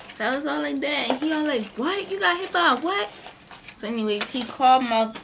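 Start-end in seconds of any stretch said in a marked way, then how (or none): 1.8-3.1 high, incredulous "by a what?"; can't believe what he just heard; shocked disbelief. Retold or imitated. Uncle hearing that his niece was hit by a car, shocked.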